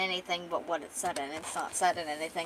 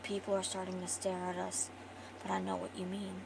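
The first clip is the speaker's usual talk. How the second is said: soft, secretive shushing; quiet acted caution; hushed warning; 9 dB quieter than usual. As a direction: keep it down so nobody overhears. Hushing her, people are staring.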